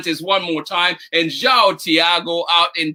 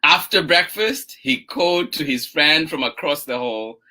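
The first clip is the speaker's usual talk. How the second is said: gentle, drawn-out story reading; picture-book narration; 4 semitones lower, slower than usual. A narrator's reading. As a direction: read slowly to little children, stretching key words. Reads picture book aloud.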